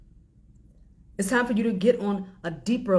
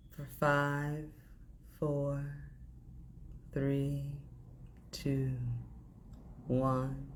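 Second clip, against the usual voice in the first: guided breathing; slower than usual. Acted. Leads a breathing exercise.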